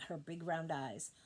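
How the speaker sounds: ordinary delivery, nothing marked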